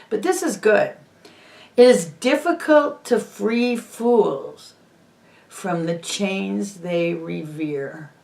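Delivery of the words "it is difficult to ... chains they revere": slow, soft, weighty recitation; solemn quote reading. Shown as a demonstration. Closing the show for viewers with a quote, slow solemn reading. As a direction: read it slowly like a closing maxim. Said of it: "I'm gonna close with a quote".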